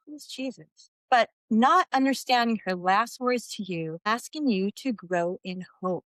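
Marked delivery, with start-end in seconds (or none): 0.1-0.9 much softer, nearly breathed question; hushed quote. Retold or imitated. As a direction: let the question fall away to a hush. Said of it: "when she asked you".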